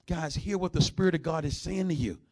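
ordinary talk

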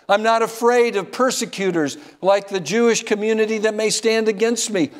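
preacher voicing Paul, growing fiercer toward Rome. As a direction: build Paul's resolve up to the last line. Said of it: "He says".

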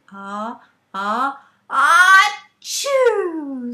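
rising ah-ah-ah into a big sneeze; sneeze buildup. Acted. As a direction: build the sneeze up, then burst. Frog sneezes in the song.

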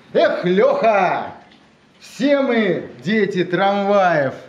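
declaiming a poem to a live audience; dramatic poetry declamation. Acted. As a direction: recite the verse with weight and rhythm. Poet reads own poem aloud.

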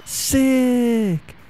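high, drawn-out cheer; thrilled. Real, not acted. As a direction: stretch the word high with delight. Thrilled by a special attack.

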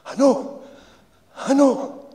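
put-on high, mumbled old Glaswegian voice; mumbling old insulator. A narrator's reading. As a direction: mumble it as the weary old worker. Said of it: "mumbled the insulator".

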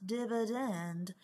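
slow, drawn-out Southern accent. Shown as a demonstration. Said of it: "say it with a southern accent".

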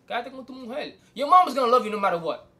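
normal talking voice

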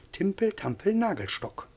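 high, swooping recital; abbé's high, silly rhyme voice; playful nonsense rhyme. Acted. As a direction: chant it high like a children's rhyme.

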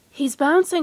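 storybook cry of excitement; excited cry. A narrator's reading. Roo sees Christopher Robin bouncing, excited cry. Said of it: "cried Roo".

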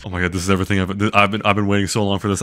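ordinary talk, nothing marked

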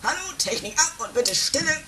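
high called-out order to the crew; calling out. Acted. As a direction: call across the studio for silence. Producer calls out to the studio.

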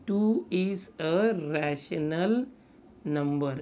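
very slow, stretched-out words; dictating. Real, not acted. Teacher gives students statement P, dictating slowly. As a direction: leave gaps for writing.

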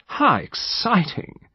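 high, slow put-on drawl; deliberately drawled young lady's voice; affected slight drawl. A narrator's reading. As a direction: draw it out affectedly, pitched high. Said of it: "came with a slight drawl".

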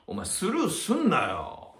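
mock scolding. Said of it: "みたいなね".

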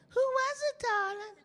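very high sugary wife's voice; sweet wife. Retold or imitated. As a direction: coo like a suspicious wife. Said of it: "His wife said".

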